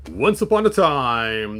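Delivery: ordinary delivery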